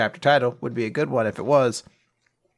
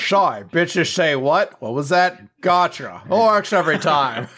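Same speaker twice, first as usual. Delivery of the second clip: loud high put-on sneer; mock bully; 5 semitones higher, 4 dB louder than usual. [name] mocking Phil, loud high cocky put-on voice.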